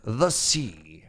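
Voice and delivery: put-on gruff pirate accent. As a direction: say it like an old sea pirate. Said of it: "I had to say it in, like, a pirate voice".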